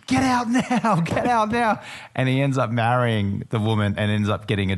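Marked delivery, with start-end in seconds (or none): 0.1-1.8 mock frantic plea, laughing through; a half-joking shout of get out now; retold urgent yell. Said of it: "He's like".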